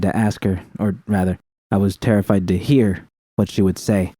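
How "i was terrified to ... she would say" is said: usual speaking voice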